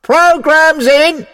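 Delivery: high, drawn-out announcing yell; loud cry. A narrator's reading. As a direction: call it out across a packed hall. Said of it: "a cry went up".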